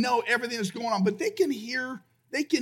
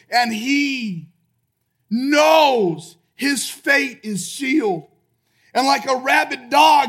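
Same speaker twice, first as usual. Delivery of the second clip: raised voice, building to full force; impassioned preaching; 3 semitones higher, 4 dB louder than usual. Real, not acted. Preacher imagining soldiers coming to the cell, impassioned preaching. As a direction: drive the story toward its climax.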